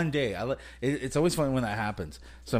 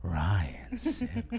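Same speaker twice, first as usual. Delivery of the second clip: "Ryan Sim" breathed as a whisper; 9 semitones lower, 6 dB quieter than usual. Shown as a demonstration. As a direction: whisper his name softly. Name repeated in whisper.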